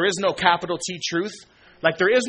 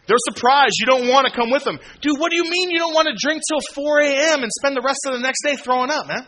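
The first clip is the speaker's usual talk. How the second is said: high, loud, incredulous mock voice; mocking party friend; 9 semitones higher, 5 dB louder than usual. Preacher mimics old friends who can't believe he quit partying, high and loud. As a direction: play the baffled party buddy, way up high.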